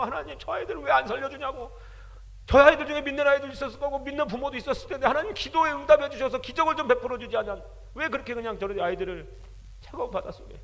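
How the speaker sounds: pleading protest to heaven; retold angry prayer. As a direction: demand answers from God in anguish. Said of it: "하나님께 막 따졌어요".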